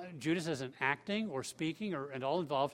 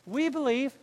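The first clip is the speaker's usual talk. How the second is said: loud, high-pitched avowal of faith; emphatic declaration; 8 semitones higher, 7 dB louder than usual. Acted. Peter answering Jesus for the twelve, emphatically declaring faith. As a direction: proclaim it with raised, insistent conviction.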